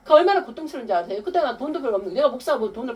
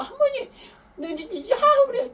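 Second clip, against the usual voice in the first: put-on much higher, eager child voice on the phone; excited little boy; 14 semitones higher, slower than usual. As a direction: squeak it out like a small grandson calling. Grandson calling grandma on phone. (전화로 할머니를 부르는 손자, 아주 높은 아이 목소리)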